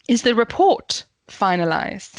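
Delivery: emphasize REPORT, then place FINALIZED apart; deliberate question with REPORT standing out; stress on REPORT. Shown as a demonstration. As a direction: REPORT punched, then a short pause. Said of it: "you might emphasize report".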